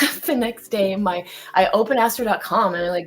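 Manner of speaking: usual speaking voice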